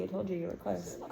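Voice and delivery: usual speaking voice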